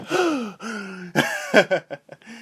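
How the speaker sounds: long gasping for air; breathless gasp. Real, not acted. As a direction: catch your breath after a long rant. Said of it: "Out of breath".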